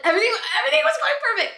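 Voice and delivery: very high, rushed mock wail; imitated sobbing. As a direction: squeak it out like someone breaking down. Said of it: "broke sobbing".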